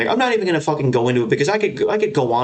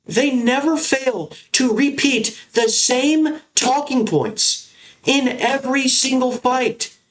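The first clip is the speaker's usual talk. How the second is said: higher, exasperated tirade; real frustration, pitched up and slowed; frustrated rant; 9 semitones higher than usual. Real, not acted. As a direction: vent about the commentators, fed up. Ranting about bad commentary.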